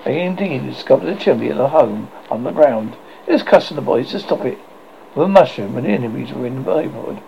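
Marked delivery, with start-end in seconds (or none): none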